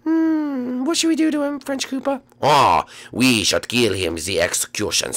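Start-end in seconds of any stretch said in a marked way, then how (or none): 0.0-2.2 very high, small character voice; squeaky cartoon voice. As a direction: chirp like a tiny cartoon sidekick.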